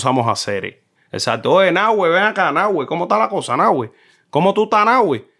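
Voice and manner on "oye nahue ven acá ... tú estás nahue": eastern Cuban sing-song. Retold or imitated. A Cuban guest mimics how easterners greet a friend, eastern Cuban sing-song. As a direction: sing the words like someone from eastern Cuba. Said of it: "porque ellos hablan así cantando".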